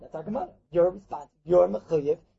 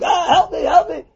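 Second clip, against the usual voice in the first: high, raised cry for help; mock screaming; 6 semitones higher, 4 dB louder, slower than usual. Householder calling for help at night, mock screaming. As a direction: call out as if being robbed. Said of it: "you start screaming".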